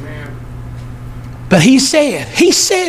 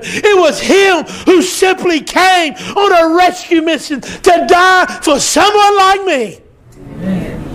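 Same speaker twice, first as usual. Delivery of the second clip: fiery preaching; 3 semitones higher than usual. Acted. Preacher rouses the church, full force shouting. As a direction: preach it hard, raising the house.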